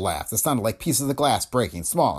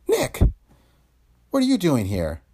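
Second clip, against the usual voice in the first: surprised; 7 semitones higher than usual. Acted. Woman startled by Nick's visit.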